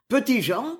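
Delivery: plain reading voice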